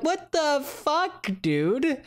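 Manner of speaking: high, slow, drawn-out outraged disbelief; replaying the moment the hero turned murderer, incredulous; stunned disbelief. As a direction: stretch it out high in shock. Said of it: "I'm like".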